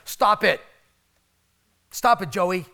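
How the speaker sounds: imitating a mom in Costco scolding her son; mock scolding mother. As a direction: snap at the kid like an annoyed mom. Retelling a mother scolding her child.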